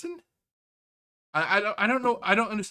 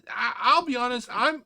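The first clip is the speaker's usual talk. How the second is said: ordinary talk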